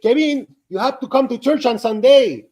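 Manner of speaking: put-on high, loud Filipino-accented invitation. Customers inviting him to church, in a high Filipino accent. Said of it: "well, in their accent".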